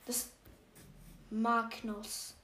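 ordinary talk, nothing marked

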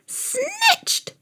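put-on very high old woman's cry, thin and soft; seven-hundred-year-old woman's cry. A narrator's reading. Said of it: "she cried in a seven hundred-year-old voice".

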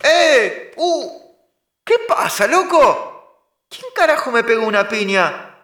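high panicked yelping. Acted. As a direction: yelp as if getting hit. Beaten up, yelling in pain.

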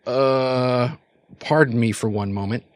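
drawn-out embarrassed "uh", then restrained apology; mortified. Acted. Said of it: "he gives you this mortified look".

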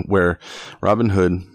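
usual speaking voice

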